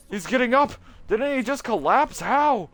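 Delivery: high, loud frightened cries; shocked alarm. Acted. Students see the teacher get up, frightened. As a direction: gasp out the horror as he rises. Crowd sees dead man rise.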